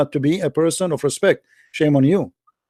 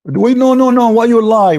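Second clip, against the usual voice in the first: heated objection; 4 semitones higher, 4 dB louder than usual. Real, not acted. Heated interruption in argument.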